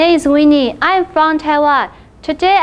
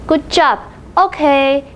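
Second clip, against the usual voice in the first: normal talking voice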